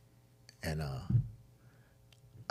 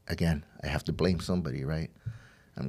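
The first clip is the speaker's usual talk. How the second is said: ordinary talk, nothing marked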